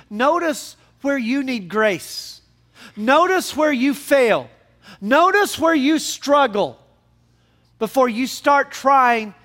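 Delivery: slow, high, forceful exhortation; emphatic preaching. Real, not acted. Preacher urging congregation to see their own failings, emphatic preaching.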